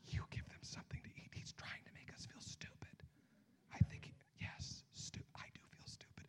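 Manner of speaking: mock whispered grumble to a friend; disciple whispering. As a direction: whisper it behind Jesus's back. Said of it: "Thaddeus looking at Andrew going".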